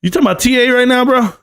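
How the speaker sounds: high, fast disbelieving "bro?"; incredulous mock reply. Mocking a rival streamer, fast and incredulous. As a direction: toss back a fast, disbelieving question. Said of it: "I was like".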